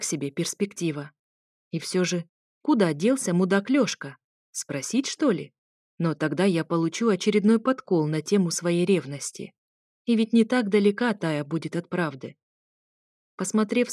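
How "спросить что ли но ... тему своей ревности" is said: plain reading voice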